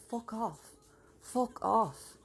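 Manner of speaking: mock-grumpy dog brushing her off; voicing the dog. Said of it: "She's like".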